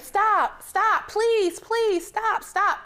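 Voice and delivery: desperate high shout; panicked yelling stop; screaming. Retold or imitated. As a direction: scream at the bus to stop, pleading. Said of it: "And I'm screaming".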